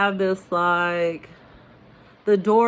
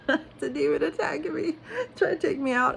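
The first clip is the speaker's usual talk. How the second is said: high-pitched, laughing through the words; 10 semitones higher, faster than usual. Real, not acted. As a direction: crack up while telling it. Laughs recalling the owl attack.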